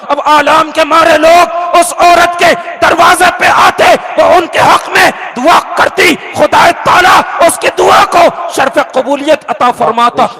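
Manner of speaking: rising sermon fervor; fervent preaching. Acted. Preacher builds to full force.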